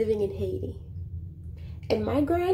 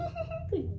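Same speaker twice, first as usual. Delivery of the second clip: high, soft put-on giggle; mock giggle; 7 dB quieter than usual. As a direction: giggle girlishly, as an act.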